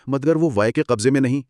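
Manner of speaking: ordinary delivery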